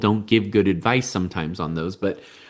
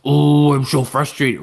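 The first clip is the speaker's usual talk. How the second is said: mirrored frustration; 3 semitones higher, 4 dB louder than usual. Acted. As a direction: play back a child's frustration. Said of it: "if they get frustrated".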